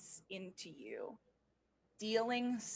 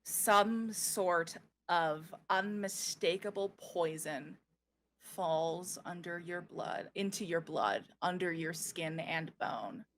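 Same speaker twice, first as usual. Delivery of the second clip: ominous narration; 4 semitones lower than usual. A narrator's reading. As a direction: narrate the poison creeping in. GM narrates poison creeping in.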